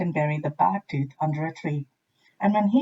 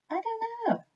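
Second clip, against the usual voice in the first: very high, soft squeak; tiny high squirrel voice; little squirrel squeaking softly; 6 dB quieter than usual. A narrator's reading. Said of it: "said a squirrel".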